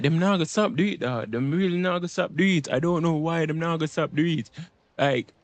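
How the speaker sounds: normal talking voice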